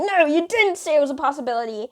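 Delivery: high-pitched mimic of an arguing friend; indignant contradiction. Friend disputing what she was told, mock indignant. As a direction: argue back, imitating her indignation.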